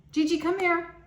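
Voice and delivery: high, loud call to a pet; calling the dog. Real, not acted. Breaking off the story to call her dog Gigi, loud high call. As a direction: call Gigi over across the room.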